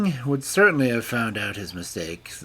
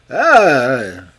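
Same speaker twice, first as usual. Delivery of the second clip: loud, startled ah; shocked cry; 4 semitones higher, 12 dB louder than usual. A narrator's reading. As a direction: gasp out in outrage. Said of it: "cried the king".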